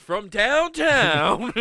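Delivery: high, loud drawn-out "From downtown"; slow hammed-up sports shout; mock announcer call. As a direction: call it like a long three-pointer. Mock basketball shot call.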